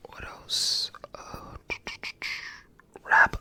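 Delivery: ordinary talk, nothing marked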